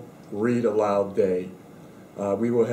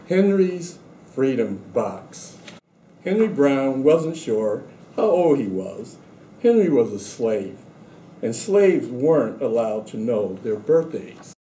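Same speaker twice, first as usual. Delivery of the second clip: story reading; 3 semitones higher than usual. A narrator's reading. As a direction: read the picture book aloud to the class. Reads picture book to students.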